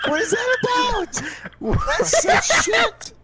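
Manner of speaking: high, indignant protest through laughter; incredulous, high laughing yell; laughing outrage. Real, not acted. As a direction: cry foul while cracking up. Protesting being shot by friend.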